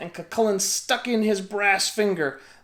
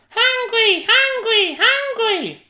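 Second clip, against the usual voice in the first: put-on much higher, louder baby wail; hollering baby; 8 dB louder, slower than usual. A narrator's reading. Giant baby Finn bawling for bread. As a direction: bawl for food like a baby. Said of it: "The baby began to holler too".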